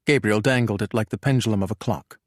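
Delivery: ordinary delivery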